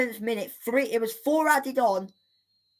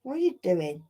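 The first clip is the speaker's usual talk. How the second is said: usual speaking voice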